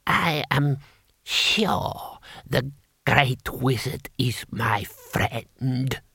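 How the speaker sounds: put-on slow, halting old ruler; feeble, drawn out to the last word; ancient emperor. Emperor turning to Rincewind, slow halting voice.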